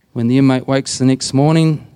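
ordinary talk, nothing marked

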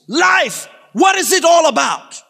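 high, loud cry to the room; big declamatory shout; skit shout. Acted. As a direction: shout it out like an actor on stage. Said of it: "Somebody gets up and goes".

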